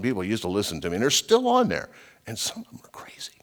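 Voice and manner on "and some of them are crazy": confiding whisper; whispered aside. Real, not acted. Preacher whispering to listeners that some followers are crazy. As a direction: let it slip under your breath.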